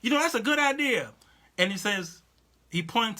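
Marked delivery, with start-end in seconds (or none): 0.0-1.1 mock Trump. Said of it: "he's pretty much was saying".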